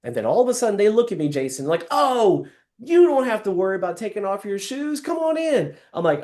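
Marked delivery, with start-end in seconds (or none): 1.9-5.8 high, easy host voice; homeowner reassuring. Acted. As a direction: wave off the worry as the host. Homeowner voiced about shoes.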